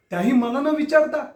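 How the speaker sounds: high, outraged question; indignant. Acted. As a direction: throw it at her in disbelief. Jealous husband confronts wife.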